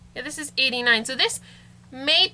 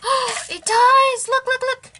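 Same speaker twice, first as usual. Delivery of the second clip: high, delighted squeal of surprise; excited gasp; 9 semitones higher, 5 dB louder, slower than usual. Real, not acted. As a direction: gasp and burst out thrilled. Popcorn machine suddenly works.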